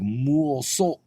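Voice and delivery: muoso drawn out as "mool-solt"; imitating woman's pronunciation. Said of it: "the way she says it".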